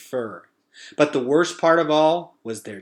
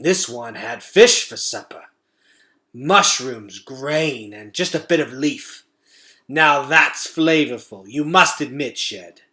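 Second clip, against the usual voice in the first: louder, relishing rat voice; sniffing gourmet rat; 6 dB louder than usual. Acted. Fangor sniffing out the boy's supper, relishing. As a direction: taste the scent on every word.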